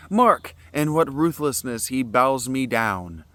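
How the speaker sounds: loud, high defiant declamation; tragic hero declaiming. Acted. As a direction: challenge the visitor proudly. Prometheus speaking in reading.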